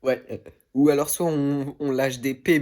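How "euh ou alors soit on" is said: normal talking voice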